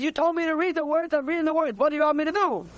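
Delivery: high-pitched retold exasperation; exasperated protest. Man protesting to the boy that he is reading, exasperated. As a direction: imitate a baffled man pleading his case.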